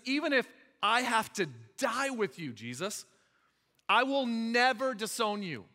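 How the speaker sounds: emphatic insistence. Acted. Peter swears loyalty to Jesus, insisting emphatically. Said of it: "Then Peter insisted emphatically".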